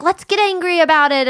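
eager animal friend. Retold or imitated. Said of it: "one's like".

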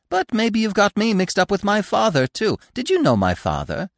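high-pitched, inquisitive squirrel; chatty squirrel. A narrator's reading. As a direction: wonder aloud in a high animal voice. Squirrel questioning Bambi.

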